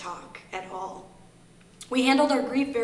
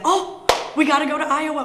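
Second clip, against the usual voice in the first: eager rush; 7 semitones higher, 9 dB louder than usual. Retold or imitated. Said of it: "I said".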